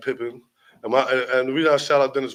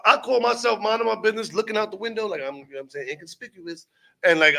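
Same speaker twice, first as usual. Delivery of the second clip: ordinary delivery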